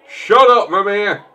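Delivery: high, loud shout at Mimir; loud yell. Acted. Yelling at talking head companion.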